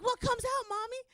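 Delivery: put-on high, curious small child; little girl voice. Little daughter asks.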